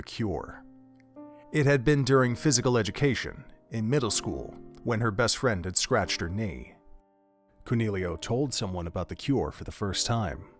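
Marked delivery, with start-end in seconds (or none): none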